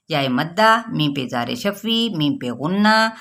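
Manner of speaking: normal talking voice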